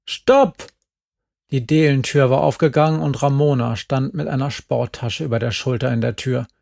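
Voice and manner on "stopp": high, loud yell; sharp shout. A narrator's reading. Ramona yells from the door to stop them, very high.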